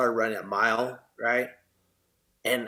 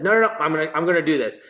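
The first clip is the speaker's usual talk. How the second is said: determined; 4 semitones higher, 6 dB louder, much faster than usual. Retold or imitated. Vowing to prove the doctors wrong.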